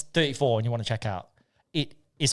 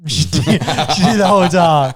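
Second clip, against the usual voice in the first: loud, slow, incredulous through laughter; laughing outrage; 4 semitones higher, 9 dB louder than usual. Real, not acted. Telling friends partner planned it all, laughing outrage. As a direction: laugh while protesting you were played. Laughing over being played.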